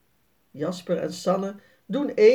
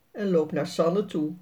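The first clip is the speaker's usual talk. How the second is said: plain reading voice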